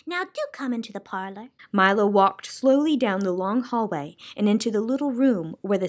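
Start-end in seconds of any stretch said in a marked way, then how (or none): 0.1-1.5 soft invitation. Acted. Soundkeeper invites softly.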